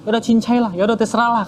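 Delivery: mock distracted father giving in; offhand brush-off. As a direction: wave the request away impatiently. Busy father brushing off the request.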